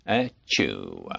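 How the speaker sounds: a deep, low mock sneeze; a grand movie-star sneeze, low and soft; Gregory Peck sneezing. Shown as a demonstration. Actor performs on request for host, low mock sneeze. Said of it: "Gregory Peck sneezing".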